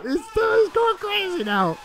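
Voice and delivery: real frantic calls to stop it; excited shouting. Real, not acted. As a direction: yell for the ref to step in. Begging ref to stop fight.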